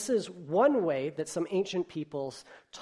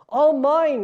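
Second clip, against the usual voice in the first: slow, much louder triumphant cry; gleeful cry; 10 semitones higher, 13 dB louder than usual. Acted. King Yertle gloating over his kingdom, loud cry. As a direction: crow over everything he owns. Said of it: "Yertle cried".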